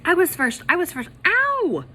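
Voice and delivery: high loud cry of protest; pained kid yell ending in ow. Acted. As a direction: shout it while yanking. Kids fighting over hat.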